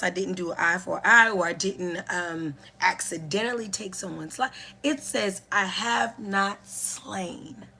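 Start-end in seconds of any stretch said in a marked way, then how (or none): 5.5-7.7 very slow, weighty "I have not slain"; slow emphasis. Shown as a demonstration. Teacher stressing the law's wording, slowly. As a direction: lay each word down. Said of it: "putting an emphasis on there".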